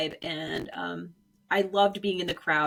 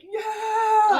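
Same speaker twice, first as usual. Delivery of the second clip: high, loud victory cry; a little kid cheering at the finish; imitated triumphant cheer; 12 semitones higher, 5 dB louder than usual. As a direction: mimic an elated "hands up" cheer.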